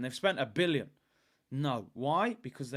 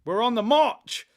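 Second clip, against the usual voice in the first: excited shout; 4 semitones higher, 7 dB louder than usual. Retold or imitated. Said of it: "I said".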